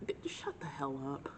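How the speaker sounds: hushed aside to herself; whispering. Real, not acted. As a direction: whisper it under your breath.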